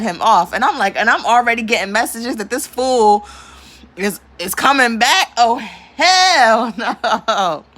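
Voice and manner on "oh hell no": slow, drawn-out hell no through a laugh; amused refusal dissolving into laughter. Real, not acted. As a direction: stretch it out while cracking up. Laughing at the memory.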